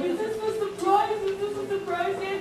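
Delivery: excited question. Retold or imitated. Kids asking dad excitedly.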